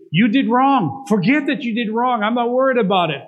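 high, loud booming forgiveness; voice of God. Acted. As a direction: proclaim it as God, lifting the voice.

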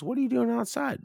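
high, surprised question; high voiced friend. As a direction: lightly voice the friend. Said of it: "Tice goes".

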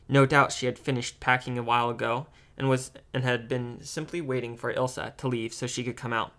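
ordinary delivery

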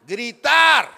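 loud drawn-out burst; emphatic yell on the last syllable; shouted. Shown as a demonstration. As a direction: shout the word out. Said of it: "Eso de a dar voces".

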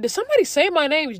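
retold high, incredulous question; puzzled asking. Asking the lady behind me, high puzzled voice. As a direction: ask it high and bewildered.

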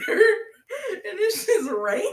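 high, loud, slow, through laughter; laughing. Real, not acted. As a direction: wheeze it out while cracking up. Laughing at the scene.

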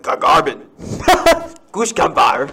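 loud made-up foreign gibberish; fake foreign language. Showing a friend the quest, fake foreign language. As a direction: blurt out nonsense as if foreign. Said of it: "something in a different language".

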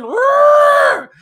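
loud, high imitated elephant roar. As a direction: bellow it like an angry elephant. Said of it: "is roaring".

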